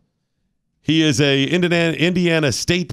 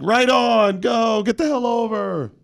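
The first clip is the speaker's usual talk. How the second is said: imitated cheering; 7 semitones higher, slower than usual. Acts out cheering commenters.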